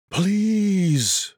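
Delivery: high pleading; desperate plea. A narrator's reading. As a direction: beg him, voice lifting high.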